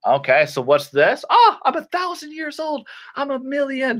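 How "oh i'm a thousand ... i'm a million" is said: high put-on old voice; comic high-pitched old-timer; mock-ancient voice. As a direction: play someone absurdly old, pitched way up. Plays a thousand-year-old.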